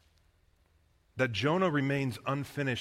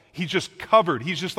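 ordinary talk, nothing marked

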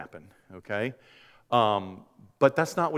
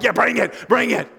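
eager congregants; 8 semitones higher, 6 dB louder than usual. Retold or imitated. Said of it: "people are like".

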